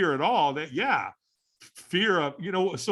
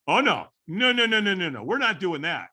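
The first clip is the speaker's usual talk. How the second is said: higher-pitched string of rapid-fire refusals; retold emphatic refusal; 5 semitones higher than usual. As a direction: replay your own alarmed inner protest. Retold inner refusal of fire walk.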